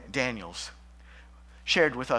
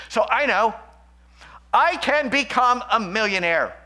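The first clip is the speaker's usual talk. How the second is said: mock greed; 7 semitones higher, 8 dB louder than usual. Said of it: "name it and claim it theology".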